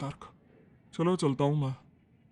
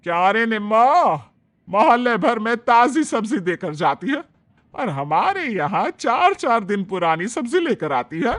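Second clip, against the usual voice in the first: loud, higher scolding of the vegetable seller; 4 semitones higher, 6 dB louder than usual. Acted. Mother-in-law scolding vegetable seller Nimma, loud and sharp. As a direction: tell her off for stale vegetables. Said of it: "शोभना उसे डांटते हुए बोली".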